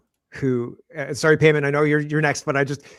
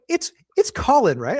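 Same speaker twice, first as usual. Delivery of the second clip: put-on British accent, pitched much higher and slow; British mother correcting how Colin is said; British mom; 10 semitones higher, 4 dB louder than usual. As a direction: imitate a British mum pronouncing Colin. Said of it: "my mom's British".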